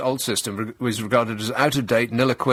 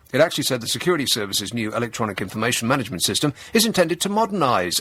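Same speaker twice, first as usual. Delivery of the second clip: quick jargon read. Shown as a demonstration. Comedian reading the report to the audience, quick and flat. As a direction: rattle off the report jargon fast. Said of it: "reading jargon report".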